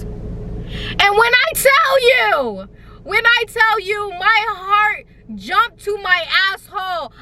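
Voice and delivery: high slow stretched panic; dramatic terror. Retold or imitated. Telling viewers her fright in slow high panic. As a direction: drag it out in mock terror.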